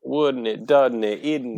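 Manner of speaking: put-on clipped-off British tag questions in accent; lightly put-on English accent; mock English accent. Echoing a friend's mockery, put-on English accent. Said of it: "Why don't we all talk like this".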